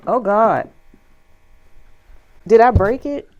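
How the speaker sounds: panicked sudden alarm. Real, not acted. Furniture piece seems to break.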